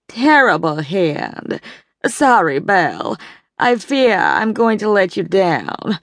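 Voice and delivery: slow, prim apology over a bad hand; a society matron sighing at her cards; Evelyn's voice. A narrator's reading. Evelyn apologizing to her partner Belle, in a prim drawl.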